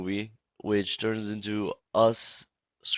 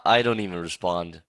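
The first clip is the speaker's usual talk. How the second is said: ordinary talk, nothing marked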